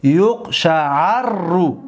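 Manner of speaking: یقشعررو stretched, a long a and a held rr; teacher modelling یقشعررو slowly; very slow model. Shown as a demonstration. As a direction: draw out یقشعررو for the class to copy. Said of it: "دقت دادید؟".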